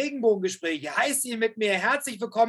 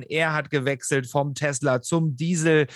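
ordinary talk, nothing marked

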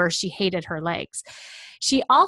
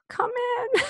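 tearful client. Retold or imitated. Client crying in dressing room.

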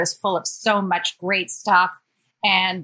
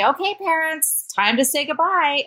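imitating college staff; 8 semitones higher than usual. [name] mimics the school sending parents home, mock official. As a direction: play the school announcing it's time to leave. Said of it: "And then when they say".

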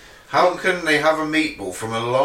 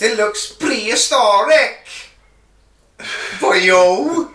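put-on loud, slow hammy Welsh accent; 7 semitones higher, 5 dB louder, much slower than usual. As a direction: overdo a Welsh accent, drawn out. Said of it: "You really do put that on the end of every".

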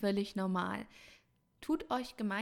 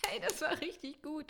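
self-praising; 8 semitones higher than usual. Real, not acted. Praising own tongue twister.

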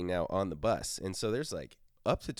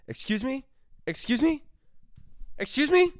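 rising higher and louder with each repeat; sleep-talking plea; 8 dB louder than usual. Retold or imitated. Todd sleep-talks on the bus, calls getting higher and louder. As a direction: build from polite to loud high calls. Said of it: "The first time was".

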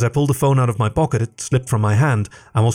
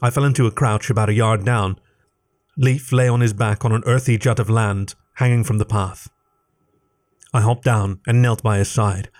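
plain reading voice

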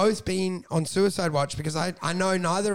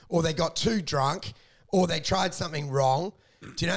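ordinary talk, nothing marked

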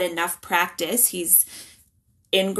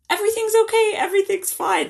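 bright put-on reassurance, pitched much higher and louder; fake cheerful; 4 dB louder, faster than usual. Mom pretending to her kids everything is fine, falsely cheerful. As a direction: sing out a forced everything-is-fine. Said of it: "putting on".

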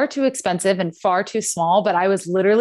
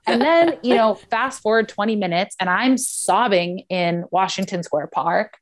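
ordinary talk, nothing marked

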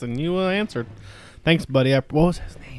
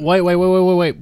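loud, higher urgent stop; urgent loud interruption; 5 semitones higher, 11 dB louder than usual. Real, not acted. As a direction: cut in loudly and urgently. Urgently stopping topic change.

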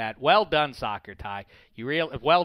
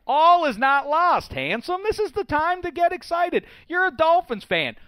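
excited; 14 semitones higher, 6 dB louder than usual. Real, not acted. Hyping up a sad fan.

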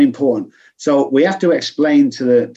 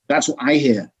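normal talking voice

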